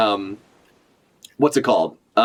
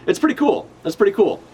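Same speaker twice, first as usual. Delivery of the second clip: excited; 6 semitones higher than usual. Real, not acted. Excited about story reveal.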